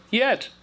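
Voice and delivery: "Yet" gesprungen hoch und leicht; bright high "Yet". Shown as a demonstration. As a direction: sing the word "Yet" up high as a cheerful correction. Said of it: "and I just go".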